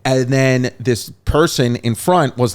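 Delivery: ordinary delivery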